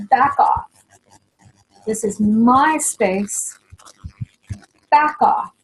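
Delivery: firm, forceful boundary; emphatic. Shown as a demonstration. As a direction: plant your feet and push them away. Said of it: "when I do it like this".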